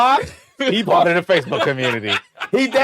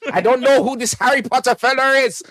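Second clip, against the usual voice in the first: high comic Scottish voice; put-on Scottish, pitched up; Scottish accent; 4 semitones higher, 4 dB louder than usual. Comedian retrying the eavesdropper's line, Scottish accent. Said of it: "was he Scottish or".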